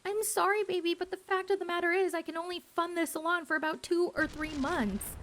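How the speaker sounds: high, pleading mock boyfriend voice; apologetic boyfriend. Broke boyfriend apologizing to his angry fiancée, high and pleading. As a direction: plead sweetly, a little too fast. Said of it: "boyfriend apologizing to fiancée".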